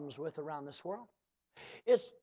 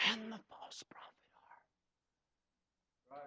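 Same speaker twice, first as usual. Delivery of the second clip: breathy hushed whisper after the shout; sudden whisper; 21 dB quieter than usual. Acted. Preacher hushing the congregation, whispered ending. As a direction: drop it to a secret whisper.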